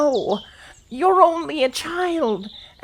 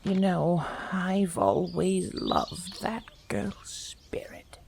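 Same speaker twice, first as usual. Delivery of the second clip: whisper; 9 semitones lower, 6 dB quieter than usual. Acted. Grandmother fondly aside.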